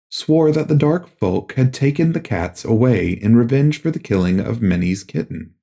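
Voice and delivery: plain reading voice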